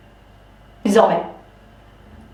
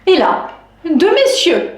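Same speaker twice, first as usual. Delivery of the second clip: ordinary talk, nothing marked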